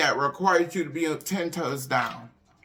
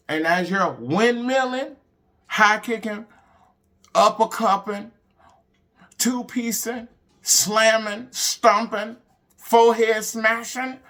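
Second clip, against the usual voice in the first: fired-up list; 6 semitones higher, 4 dB louder, slower than usual. Real, not acted. Hype list of fight moves.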